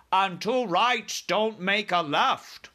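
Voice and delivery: high, slow, loud retort; BFG crying out. A narrator's reading. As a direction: chime in with a drawn-out giant's cry. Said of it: "cried the B F G".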